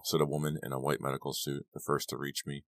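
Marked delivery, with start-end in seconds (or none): none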